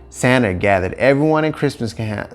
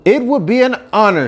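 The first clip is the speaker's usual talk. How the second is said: put-on high, loud, eager childlike reply; eager young reindeer; 11 semitones higher, 4 dB louder than usual. Rudolph answering Santa, high and eager. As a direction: accept proudly and gladly.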